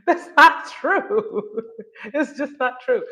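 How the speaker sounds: amused, high-pitched rebuttal broken by laughs; laughing disagreement. Real, not acted. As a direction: laugh while flatly contradicting someone. Laughing while contradicting the elders.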